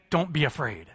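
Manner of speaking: soft reassurance. Acted. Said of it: "Jesus there saying".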